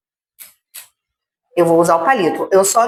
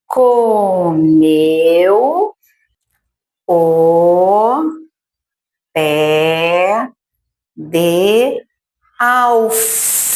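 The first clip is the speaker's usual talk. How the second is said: slow word-by-word review; much slower than usual. Shown as a demonstration. Teacher reviewing the sentence word by word with children. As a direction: point at each word as you say it. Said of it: "Vamos revisar, então, a frase".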